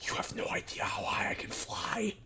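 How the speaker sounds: soft, intense whisper with force; a whispered boast; stage whisper. Acted. The kid whispering a boast through the bars.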